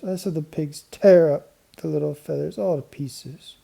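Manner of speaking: put-on a little girl complaining about her hat. A narrator's reading. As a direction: sound like an upset little girl. Babs tells about feathers.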